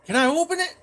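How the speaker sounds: very high, loud imitation of Mom; mock-mom squeal of a question; yelling mom. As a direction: holler it like an eager mother. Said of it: "and she yells".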